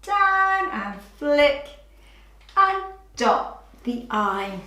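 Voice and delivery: slow, drawn out. Shown as a demonstration. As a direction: narrate the stroke slowly for a child. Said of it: "we'll practice writing".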